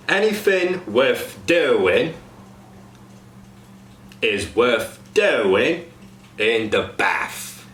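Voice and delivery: slow Cockney, "anything" as anyfing, "bath" as baf; slow Cockney example. Shown as a demonstration. Coach demos TH fronting to cast. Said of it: "Example sentence".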